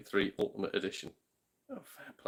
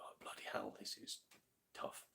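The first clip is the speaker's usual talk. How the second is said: whispered imitation of the kid; 19 dB quieter than usual. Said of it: "I know he's gonna go".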